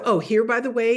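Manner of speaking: normal talking voice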